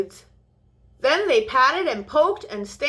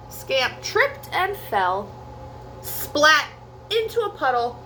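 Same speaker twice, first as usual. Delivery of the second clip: plain reading voice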